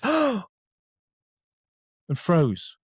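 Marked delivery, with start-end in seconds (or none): 0.0-0.4 sharp high gasp of shock, then Oh; startled gasp. Retold or imitated. Therapist walks in and gasps at him, shocked. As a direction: imitate her sudden shocked intake.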